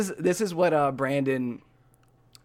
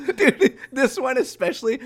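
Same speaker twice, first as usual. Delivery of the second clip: high-pitched through laughter; laughing; 10 semitones higher, 4 dB louder than usual. Real, not acted. Quiz reader agrees the pictures are absurd, laughing. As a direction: still cracking up while talking.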